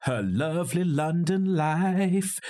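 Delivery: high, drawn-out singsong gush; mock-dreamy lilt. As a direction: stretch it out with sugary mock enchantment. Mocking the film's idyllic London setup.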